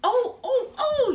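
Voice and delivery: high, loud yelling oh; yelling boy. Acted. The little boy yells as he wrestles Ping, loud. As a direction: yell like a startled little boy. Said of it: "yelled the little boy".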